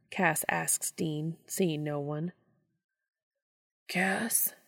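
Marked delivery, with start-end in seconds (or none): 3.9-4.5 faint breathy whisper from afar; weak whisper. Acted. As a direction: barely voice it, weak. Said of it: "a weak voice asks".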